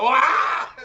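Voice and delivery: high, loud startled yelp; the shock of seeing snakes at the door; retold scream. Agent meets snake-draped tenants, loud high shriek. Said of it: "And the people would go".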